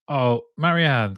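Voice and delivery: usual speaking voice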